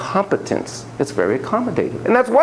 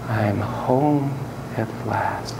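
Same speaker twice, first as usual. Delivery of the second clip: very slow and softer, settling on "home"; 5 semitones lower, 6 dB quieter, much slower than usual. Shown as a demonstration. Students with eyes closed, saying it slowly and softly. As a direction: breathe it out slowly like finally arriving. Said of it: "close your eyes and say".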